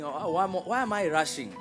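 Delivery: usual speaking voice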